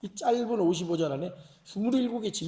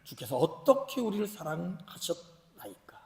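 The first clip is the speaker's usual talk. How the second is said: slow, soft, hushed quotation; scripture reading; 2 semitones lower, 6 dB quieter, slower than usual. Acted. Israel's question to God read from scripture, hushed. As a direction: read the verse slowly and quietly. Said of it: "하나 너희는 이르기를".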